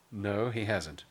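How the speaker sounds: usual speaking voice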